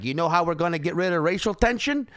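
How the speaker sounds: loud, high Chicago mayor voice; hammed-up Chicago accent. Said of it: "This is a terrible Chicago accent".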